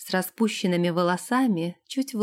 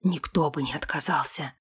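low, whispery, understated fervour; ardent; 5 semitones lower, 4 dB quieter than usual. A narrator's reading. Captain reassures the princess nobody would refuse her, ardent. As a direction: swear it in a lowered, breath-filled voice. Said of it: "пылко воскликнул Сэм".

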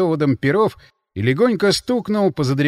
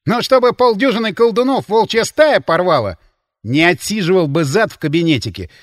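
loud, raised angry retort; angry hiss; 5 semitones higher, 7 dB louder than usual. A narrator's reading. Линь defends himself to the counterintelligence man, angrily. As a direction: snap back in indignation. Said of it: "зло прошипел Линь".

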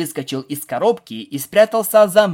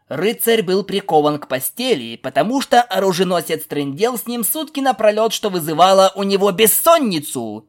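indignant, higher, slightly raised voice; 5 semitones higher, 4 dB louder than usual. Acted. Stallion answering the pegasus's version of the knight tale, raised voice. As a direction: tell the tale back with rising irritation. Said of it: "Никто повысил голос".

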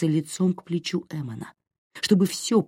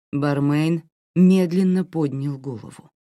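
usual speaking voice

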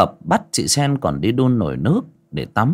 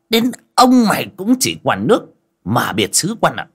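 higher, loud cursing tirade; furious master; 6 semitones higher, 3 dB louder than usual. Acted. Master curses the maid over the dusty painting, furious. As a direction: rage at her like a petty tyrant.

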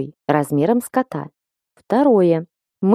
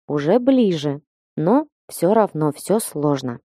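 plain reading voice

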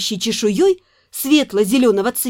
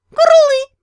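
high loud joyful creature call; loud high delighted dragon call; happy dragon chirp; 13 semitones higher, 6 dB louder than usual. Acted. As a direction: chirp for joy like a baby dragon. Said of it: "обрадовался дракон".

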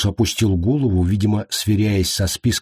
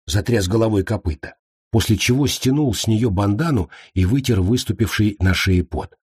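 ordinary delivery

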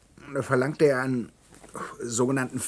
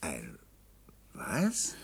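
shocked whisper; 8 semitones lower, 9 dB quieter than usual. Acted. Shocked at spanish agent news.

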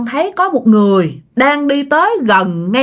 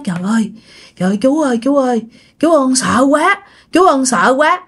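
frightened; 2 semitones higher than usual. Acted. Frightened man calls uncle for help.

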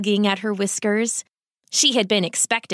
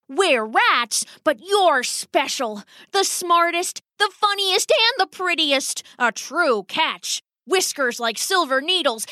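higher, hammed-up gushing praise; lightly voiced proud old rat; doting dramatic father; 6 semitones higher than usual. Father gushes over daughter.

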